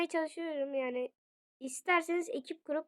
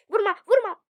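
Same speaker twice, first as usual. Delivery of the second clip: loud, higher cry to stop; panicked plea; 7 semitones higher, 12 dB louder than usual. Real, not acted. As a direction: beg the attacker off, loudly. Pleading during attack in game.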